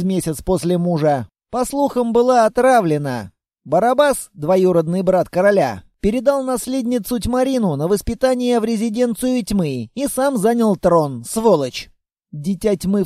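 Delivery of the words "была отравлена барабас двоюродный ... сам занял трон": plain reading voice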